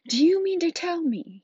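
slow, loud, high-pitched angry demand; growling Hagrid. A narrator's reading. Giant confronts the Dursleys, angry growl. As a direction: growl it out with mounting outrage. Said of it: "he growled at the Dursleys".

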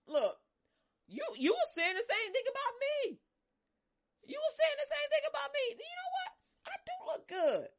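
high, loud sassy comeback; confident comeback. Retold or imitated. Preacher voicing the woman answering her mockers. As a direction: flip it back on them proudly.

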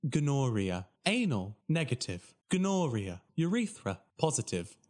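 robotic Siri-like readout, each word separate; automated phone voice. Retold or imitated. Results hotline listing STD results, stilted automated voice. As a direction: recite each item with the same falling tune. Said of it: "imagine Siri giving you your S T D results".

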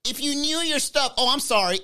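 high, nasal-free snooty pedant voice; nitpicking commenter. Retold or imitated. Commenter correcting Manny, high snooty voice. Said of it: "somebody in the comments would be like".

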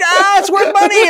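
a hammed-up wail of dismay; dismayed cry. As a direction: cry out as the toy snaps. Parent dismayed at broken toy.

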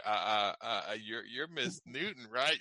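starstruck stammer, halting on "uh"; tongue-tied. Retold or imitated. Fan facing [name], imitating tongue-tied awe. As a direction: stumble through it as if meeting a crush. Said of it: "I would be tongue-tied".

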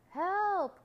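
high, loud drawn-out call for help; frightened cry. Acted. Frightened voice calling from the woods, high frightened cry. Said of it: "frightened voices".

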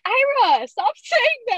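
high, loud "Ira, stop saying that"; mock scolding. Mom telling the host how she scolds her son, high and loud. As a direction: scold the kid, half laughing. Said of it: "And I'm like".

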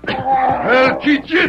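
drawn-out yelled threat; furious threat. Acted. Trainer threatening the bear before whipping, furious shout. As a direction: lash out at the bear.

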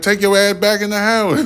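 put-on high bratty kid voice; bossy little kid. Youngest kid telling the man off, high bratty voice. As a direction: order him off like a sassy child. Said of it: "And the little one, like".

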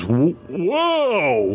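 high, startled whoa; startled giant. Acted. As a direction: yelp in sudden surprise. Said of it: "the giant goes".

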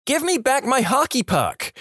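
imitating a high, squeaky kid's yell; high-pitched yell. Narrator as a mad kid whose sister hid the puck, high-pitched mock yell. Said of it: "yelled in my high-pitched voice".

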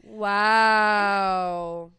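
long, loud drawn-out wow; mock offended. Friend told she's not as close, mock-offended drawl. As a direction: stretch it out in pretend offence.

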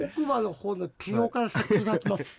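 mush-mouthed mock dentist impression. Host teasing his co-host as a lookalike dentist, mock slurred impression. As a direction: slur it like a dentist who needs dental work. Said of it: "そんな喋り方しないでしょ".

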